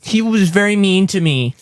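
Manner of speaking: high whiny tattletale voice; whiny baby voice. Retold or imitated. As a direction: whine like a baby to mom. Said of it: "You tell him".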